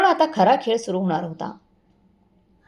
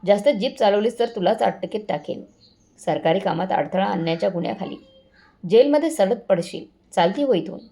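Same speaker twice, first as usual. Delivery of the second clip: usual speaking voice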